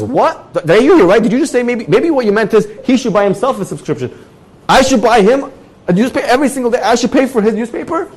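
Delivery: high, incredulous protest; can't believe the ruling; outraged disbelief. Retold or imitated. Stunned by rabbi's ruling.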